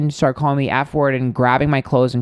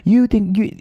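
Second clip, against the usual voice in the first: higher, strained mock scream of a crude heckler; mocking heckler yell; 8 semitones higher than usual. Heckler shouting from his pickup, a mildly mocking high yell. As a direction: imitate the man yelling from his car. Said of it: "starts screaming".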